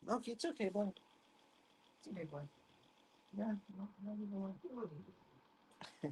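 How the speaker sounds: hushed, gentle soothing; calm the frightened dog softly; soothing a scared dog. Real, not acted. Calming his scared dog.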